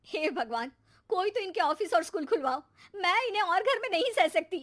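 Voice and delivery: high, frustrated appeal; exasperated plea. Acted. Wife begging god in frustration, high. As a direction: cry out to heaven.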